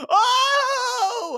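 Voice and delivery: a mock wail of shock; shocked gasp. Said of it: "she goes".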